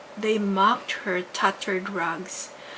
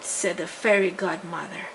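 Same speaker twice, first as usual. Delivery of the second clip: plain reading voice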